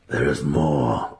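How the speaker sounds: low, slow, pressing; urgent wizard. A narrator's reading. Wizard pressing the matron, low. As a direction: hold her attention. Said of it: "Alton said quickly".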